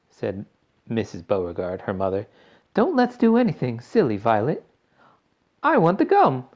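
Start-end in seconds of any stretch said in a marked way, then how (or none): 2.7-4.6 fussy mother voice. A narrator's reading. Mother pleading. 5.6-6.5 put-on stubborn, high and loud girl; obstinate. A narrator's reading. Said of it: "Violet said obstinately".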